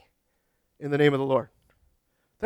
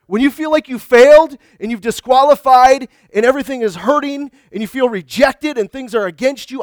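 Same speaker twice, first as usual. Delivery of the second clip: louder, fervent pulpit preaching; preaching at full force; 4 semitones higher, 5 dB louder than usual. Acted. Preacher urging his congregation they are not alone, fervent. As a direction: lift the voice and press the promise home.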